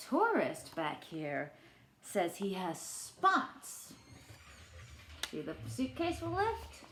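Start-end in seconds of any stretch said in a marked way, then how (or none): none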